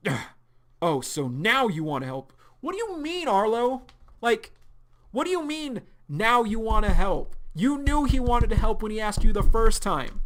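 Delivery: high, loud indignant rant; angry scolding. Acted. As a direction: tear into him for refusing help. Sarah angrily scolds Arlo.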